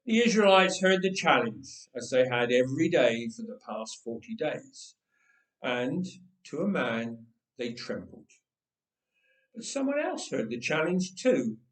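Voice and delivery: usual speaking voice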